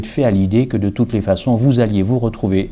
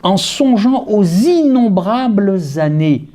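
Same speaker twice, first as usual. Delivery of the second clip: higher, fervent preacher reading; 6 semitones higher than usual. Acted. Preacher reading the quote aloud to his flock, rising fervour. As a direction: lift the quotation with mounting fervour.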